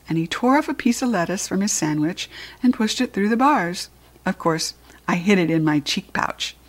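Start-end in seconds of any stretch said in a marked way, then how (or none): none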